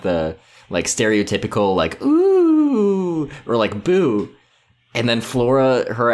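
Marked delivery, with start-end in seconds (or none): 2.0-3.3 hammed-up spooky ghost moan; playful imitation of cast members acting as ghosts; ghost impression. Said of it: "doing their best impression of being ghosts". 3.8-4.3 ghost boo. Retold or imitated. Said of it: "doing their best impression of being ghosts".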